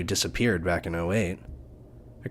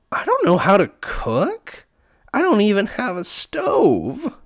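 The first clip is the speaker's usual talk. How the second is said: put-on high, loud snooty voice with a dopey childish edge; snooty dopey girl; 13 semitones higher, 6 dB louder than usual. Heather brushing off the pie joke, high snooty whine. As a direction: play her prissy but dim. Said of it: "says this with snooty flair".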